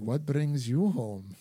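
slow, put-on Russian accent line; a light imitation of her Russian-accented movie line; imitating Florence Pugh's Russian accent. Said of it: "she's like".

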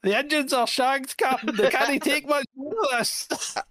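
hammed-up ship engineer in trouble; mock Scottish engineer voice; Scotty impression. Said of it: "when you're doing Scotty".

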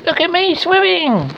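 very high, loud, drawn-out squeak; Roo's squeak. A narrator's reading. Roo showing off from the pool, high excited squeak. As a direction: squeal it like a thrilled little animal. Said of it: "squeaked Roo".

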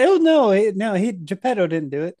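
high loud flustered denial; panicky Vanini. Retold or imitated. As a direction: deny it too fast, caught out. Vanini voiced as a liar.

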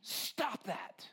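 put-on dad scolding his boys for laughing. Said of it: "I got mad".

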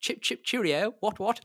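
put-on high, loud caricature English accent; mock English accent. Relatives mimicking his English accent, high mock English. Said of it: "the English accent's like".

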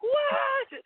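a high, mock-shocked "what?". Agent hears about the snake shoot, shocked high "what?". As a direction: squeak it like you can't believe it.

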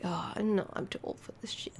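mock-weary sigh that fades to a whisper; old-timer grumble fading out. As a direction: trail off into a tired whisper.

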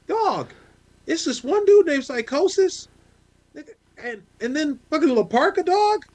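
high, excited gushing about wrestling heroes; hyped-up fan. Retold or imitated. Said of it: "He was like".

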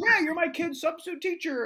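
high, loud mimicked dad; parent's voice. Parent recognises his kid's substitute, high mock-parent voice. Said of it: "And he goes".

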